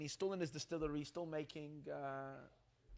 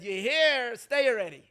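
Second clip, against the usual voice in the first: much louder, a firm command; firm Rebbe; 6 semitones higher, 12 dB louder than usual. Retold or imitated. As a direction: settle it loudly, no arguing. Rebbe tells him to stay.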